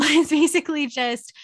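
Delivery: laughing through the words; a quick laugh breaking in. Real, not acted. Laughs while explaining.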